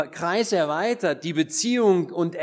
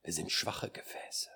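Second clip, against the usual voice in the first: low, whispered, reverent musing; hushed solemnity; 4 semitones lower, 13 dB quieter than usual. Retold or imitated. Preacher recalls a fatherly friend pondering standing before the Lord, hushed solemnity. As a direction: whisper it with long, awed pauses.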